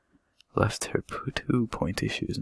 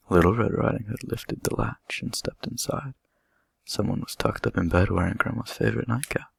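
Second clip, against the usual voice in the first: ordinary delivery, nothing marked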